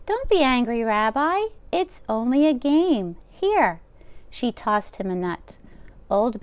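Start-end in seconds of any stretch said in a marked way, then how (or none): 0.1-3.8 put-on higher, louder fussing old woman; old grandmother voice. Old baba taking the rabbi's coat, high and fussing. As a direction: bustle like a kind deaf baba. Baba voicing lines to Bear.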